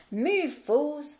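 loud, sharp order to the flock; the collie hustling the sheep with a bark; snapping sheepdog. Acted. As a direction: snap at them like an impatient collie. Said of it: "snapped Fly".

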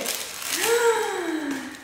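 high breathy gasp of joy; excited gasp; delighted gasp. Real, not acted. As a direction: gasp as if seeing a treasure. Sees matching underwear.

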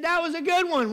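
amused woman. Retold or imitated. Quotes laughing wife's delighted remark.

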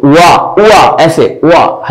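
वा as a light "u-wa"; light W glide. Shown as a demonstration. Teacher modelling the glide students should add, light rounded "u-wa" for वा. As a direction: let a slight rounded W lead into वा. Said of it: "हल्का सा आना चाहिए".

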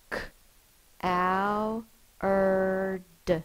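"K, ow, er, d" each sound apart. Shown as a demonstration. Teacher sounding out coward for the class, sound by sound. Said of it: "Sound it out".

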